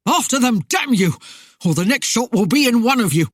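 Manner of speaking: loud, high, threatening shout; raging commander at full voice; enraged. A narrator's reading. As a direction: drive the men out with a furious threat. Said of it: "His eyes filled with rage".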